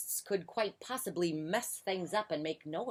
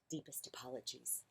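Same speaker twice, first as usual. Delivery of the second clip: whispered, contrite reply; hushed apology; 5 semitones lower, 15 dB quieter than usual. A narrator's reading. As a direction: apologise under the breath. Mouse apologises to annoyed Bear.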